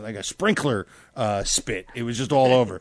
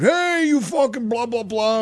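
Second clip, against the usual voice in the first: mock yell; 8 semitones higher, slower than usual. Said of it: "I don't look and go".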